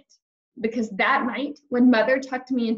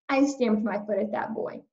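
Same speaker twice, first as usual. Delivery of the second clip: usual speaking voice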